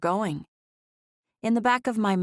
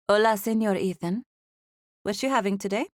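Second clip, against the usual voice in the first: put-on authentic Mexican accent; 3 semitones higher than usual. A narrator's reading. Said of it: "in a real, authentic Mexican accent".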